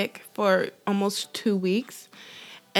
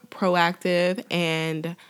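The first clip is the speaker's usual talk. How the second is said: ordinary talk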